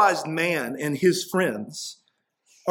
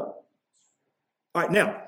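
usual speaking voice

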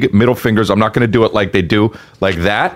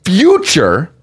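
higher, condescending teacher; mock lecturer; 7 semitones higher than usual. Guest lecturing the host pedantically, much higher and condescending.

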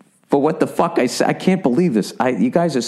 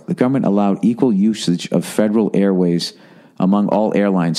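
low, slower careful reading; 5 semitones lower than usual. Shown as a demonstration. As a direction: read it again, more slowly and deliberately. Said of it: "Let me read this slower".

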